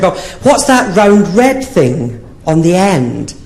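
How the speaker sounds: slow, curious lady voice; mock naive woman. Innocent woman quizzing the comic, lightly voiced slow.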